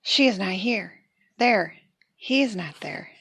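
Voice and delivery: ordinary delivery, nothing marked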